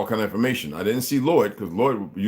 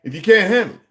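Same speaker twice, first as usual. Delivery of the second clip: raised, loud, heated tirade; higher and louder street-corner fury; angry rant; 5 semitones higher, 4 dB louder than usual. Real, not acted. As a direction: preach it hard at the crowd, fired up. Heated rant about banks and unity.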